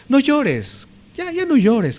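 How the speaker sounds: high-pitched, put-on hushing of a mourner; mock consoling. Someone arriving at the wake hushes the mourner, mock consoling. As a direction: imitate someone telling a mourner to stop, high.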